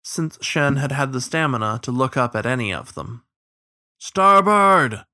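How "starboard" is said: long, loud, high bellow; bellowed command. A narrator's reading. Captain to his crew on a racing river, bellowed. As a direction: bellow the order over the rain. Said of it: "the captain bellowed again".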